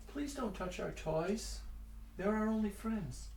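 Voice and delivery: put-on high, slow pleading ghost child. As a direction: plead softly like a lost child. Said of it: "same voice you heard outside".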